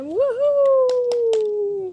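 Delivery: long high, loud "woohoo" cheer; excited whoop. Real, not acted. Proud supporter cheering on their girl, excited whoop. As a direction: let out a drawn-out, ringing whoop.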